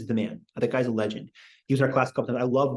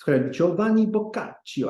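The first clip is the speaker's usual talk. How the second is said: Giovanni Boccaccio in a higher, hammed-up Italian accent; mock Italian accent; 6 semitones higher than usual. Shown as a demonstration. Teacher ridiculing fancy name pronunciation, mock Italian. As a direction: sneer it out like a snobby Italian. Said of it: "It's so obnoxious".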